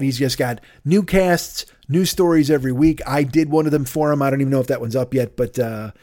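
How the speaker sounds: normal talking voice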